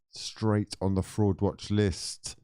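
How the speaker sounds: drawn out, every word weighted; slow, deliberate teasing decree; slow emphatic repeat. Real, not acted. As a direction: hammer the mock verdict home, slowly. Repeating the mock verdict slowly.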